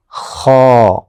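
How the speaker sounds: خو with a rasping, heavy throat sound; thick kho. Shown as a demonstration. Teacher modelling the thick throaty sound of خو. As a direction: scrape خو thickly from the back of the throat. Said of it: "تھوڑی موٹی آواز ہے".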